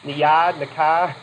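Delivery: quick Boston accent demo. Shown as a demonstration. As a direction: drop the r's like a Bostonian. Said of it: "Can you speak like you're from Boston?".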